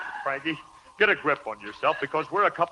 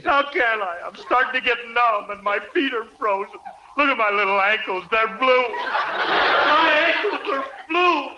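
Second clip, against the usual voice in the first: worked up; 5 semitones higher than usual. Acted. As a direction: panic comically over freezing limbs. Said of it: "What are you getting excited about?".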